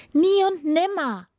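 high, loud princess refusal; indignant cry. A narrator's reading. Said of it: "rief die Prinzessin". (empörter, lauter Ausruf der Prinzessin)

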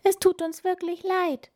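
high, earnest mouse squeak; meek piping voice; apologetic mouse. A narrator's reading. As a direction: plead sorry as a tiny mouse. Mouse apologises again in character.

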